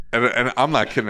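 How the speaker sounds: ordinary talk, nothing marked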